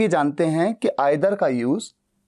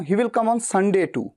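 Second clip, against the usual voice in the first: slow, Sunday too stressed; much slower than usual. Shown as a demonstration. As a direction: lean on Sunday too, slowly. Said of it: "Sunday टू का तो मतलब".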